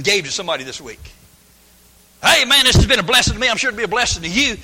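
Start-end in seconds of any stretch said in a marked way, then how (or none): 2.2-4.6 higher, louder friendly pitch; bright mock witness; cheery tract giver. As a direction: hand it over like a salesman. Acting out handing a tract.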